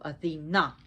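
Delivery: Athena as a-thee-NAH, last syllable punched; Athena demonstrated with the beat on NAH; stress on last syllable. Shown as a demonstration. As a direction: hit the final "nah" of Athena. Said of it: "the emphasis on the last part".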